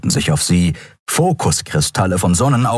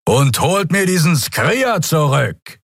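shouted order; 8 semitones higher, slower than usual. Acted. Lieutenant shouts order to his men.

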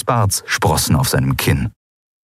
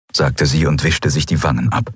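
usual speaking voice

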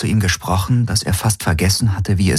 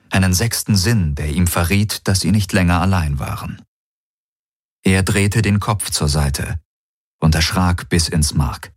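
plain reading voice

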